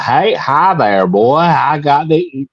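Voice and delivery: put-on slow, drawn-out Southern American accent. Brit imitating Americans to compare regional accents, slow mock Southern accent. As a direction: put on a stretched-out Southern drawl. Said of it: "like, the Southern accent".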